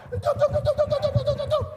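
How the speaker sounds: very high, loud rapid beeping; frantic scanner beeps. Retold or imitated. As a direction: fire off beeps in a mad rush. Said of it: "She got mad".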